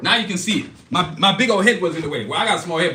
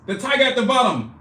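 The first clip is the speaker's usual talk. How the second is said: ordinary delivery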